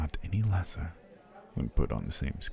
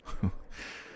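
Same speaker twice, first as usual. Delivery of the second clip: soft breathy chuckle; 5 dB quieter than usual. Acted. Joking about a potion for two.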